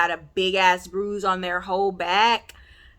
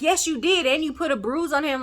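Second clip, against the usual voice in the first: mildly heated retort, pitched up; retold comeback, higher and insistent; acted accusation; 7 semitones higher than usual. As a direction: imitate a mother firing back in an argument. Acted quarrel, mother accusing partner.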